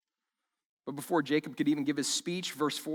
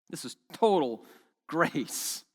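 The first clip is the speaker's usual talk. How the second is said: normal talking voice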